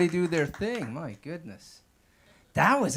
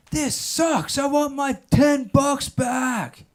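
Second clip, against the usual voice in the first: high yelled heckle, slowing at the end; screamed heckle; 10 semitones higher, 4 dB louder, much slower than usual. Retold or imitated. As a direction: yell it from the back of the room like an angry heckler. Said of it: "He screams it".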